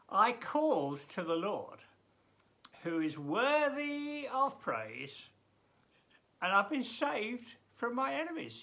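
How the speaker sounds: loud, high, drawn-out recitation; impassioned scripture reading; fervent psalm reading. Acted. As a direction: lift the verse up in praise.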